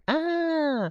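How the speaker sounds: short high yelp of panic; sudden high-pitched "Ah!"; mock scream. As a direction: mimic a fleeing crowd's scream. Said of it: "people running off going".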